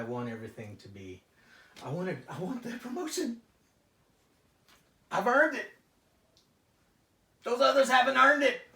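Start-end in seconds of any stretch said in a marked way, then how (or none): 1.8-8.6 angry outburst. Acted. Client erupts about promotion.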